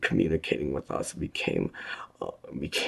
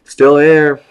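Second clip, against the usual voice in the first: loud, higher call; called back; 13 semitones higher, 21 dB louder than usual. A narrator's reading. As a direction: answer loudly from below. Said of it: "one responded from down below".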